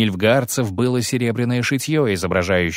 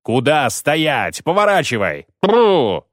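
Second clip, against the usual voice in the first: drawn-out, moderately raised halting call; shouted order; 4 semitones higher, 3 dB louder, slower than usual. A narrator's reading. Geralt turns back a fleeing soldier, shouting orders. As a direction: call the runaways back, building at the end. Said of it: "крикнул он".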